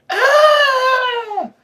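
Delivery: long, loud, high scream; angry shriek, very high and sustained. A narrator's reading. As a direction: scream out in frustration. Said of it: "I let out an angry scream".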